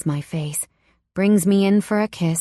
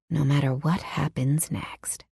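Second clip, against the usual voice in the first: ordinary delivery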